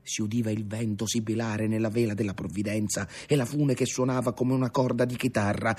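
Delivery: ordinary delivery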